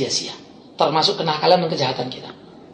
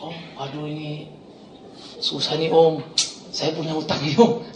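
sheepish plea; 3 semitones lower, 6 dB quieter than usual. Retold or imitated. Thug timidly asks pastor for help.

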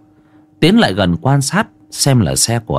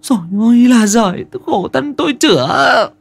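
high-pitched acted lament of a distraught wife; whimpering self-pity in a high voice; tearful wailing; 12 semitones higher than usual. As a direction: wail it, pitched way up. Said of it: "Chị Giang cứ mếu máo".

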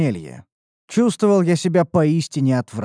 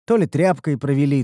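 plain reading voice

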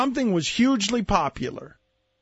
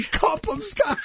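higher, laughing mock Scottish accent; laughing Connery impression; 10 semitones higher than usual. Cohost copying the Bond bit, laughing mock Scottish. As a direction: laugh through a Connery line. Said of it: "That's how Bond, James Bond would say it".

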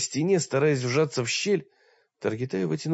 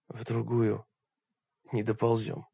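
much softer, almost no voice left; barely audible; 2 semitones lower, 5 dB quieter than usual. Acted. Exhausted Oleg answering Targitai almost inaudibly. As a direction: say it so faint you hardly hear yourself. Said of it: "сам едва услышав свой голос".

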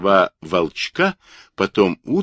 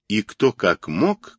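plain reading voice

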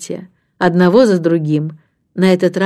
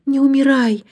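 put-on high-pitched girlish wail; wailing plea; 8 semitones higher than usual. A narrator's reading. Антея joining Джейн's cries to nanny, wailing plea. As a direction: plead in alarm, pitched right up. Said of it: "присоединила к ней свои вопли Антея".

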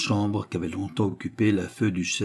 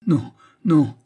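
high, loud protest; agitated refusal; 6 semitones higher, 7 dB louder than usual. A narrator's reading. Widow rejecting the duke's offer, agitated. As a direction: refuse him emphatically.

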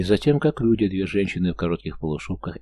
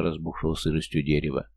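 plain reading voice

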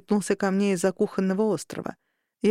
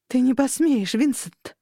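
desperate shout; 5 semitones higher than usual. A narrator's reading. Rinda defying the villain.

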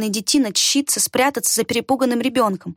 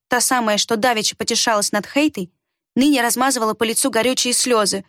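usual speaking voice

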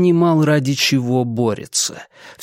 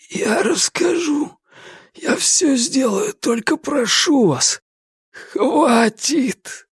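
sobbing plea; 12 semitones higher, slower than usual. Acted. Tortured prisoner breaks down.

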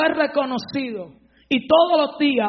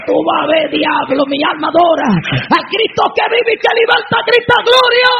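transformed shout; 2 semitones higher, 6 dB louder than usual. Retold or imitated. Preacher rebuking the devil in the flirting woman, building to a huge shout. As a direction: rebuke the devil at full force. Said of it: "ahí yo me transformo".

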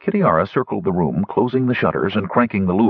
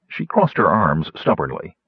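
ordinary delivery, nothing marked

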